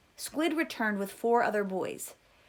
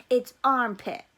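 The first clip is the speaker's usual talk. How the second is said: much louder, hard declaration; angry; 3 semitones higher, 9 dB louder than usual. A narrator's reading. Boy throwing Stanley down, loud angry correction. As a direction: spit out the nickname, much louder.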